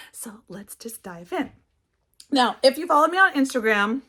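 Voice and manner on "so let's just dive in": whisper. Real, not acted. Hushed intro to the haul.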